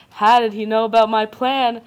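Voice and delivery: ordinary talk, nothing marked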